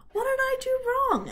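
high, loud, puzzled piglet; bewildered piglet. Acted. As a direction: cry out confused after the fall. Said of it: "asked the pig".

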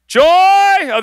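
high, loud call for someone. Real, not acted. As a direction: call a colleague up from the crowd. Calling pastor from the stage.

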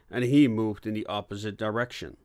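ordinary delivery

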